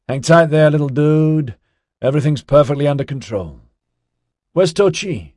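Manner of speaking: loud, high dude calling out; breezy reassurance. A narrator's reading. Ozzy to scared Orion, loudly reassuring. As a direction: call out breezy encouragement to a kid.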